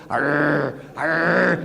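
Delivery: low mock dog growl. The family dog growling at him, low mock growl. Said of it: "she's just going".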